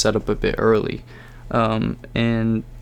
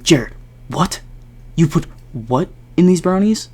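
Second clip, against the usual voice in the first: high, louder stunned cry; can't believe what he just heard; shocked disbelief; 7 semitones higher, 4 dB louder than usual. Retold or imitated. To Jared, learning about the LSD, stunned and high.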